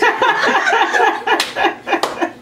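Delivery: a big real burst of laughter; loud high laugh. Real, not acted. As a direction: crack up loudly.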